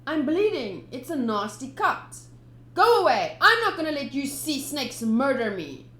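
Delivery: put-on high, loud angry boy yelling; a cornered kid cook shouting back; young Sanji defiant. Kid defying pirates, high loud yell.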